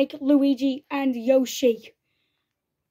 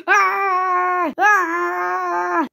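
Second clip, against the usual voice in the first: two long high wails; play-acted drawn-out shrieks; mock screams; 5 semitones higher, 4 dB louder than usual. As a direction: scream as you go down defeated. Villain defeated.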